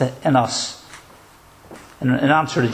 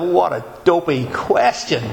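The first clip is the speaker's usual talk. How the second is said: scornful inner thought; 4 semitones higher than usual. Retold or imitated. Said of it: "And you think to yourself".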